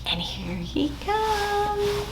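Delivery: slow high whisper; hushed, building suspense. Acted. Announcing the bear's arrival to kids, whispered.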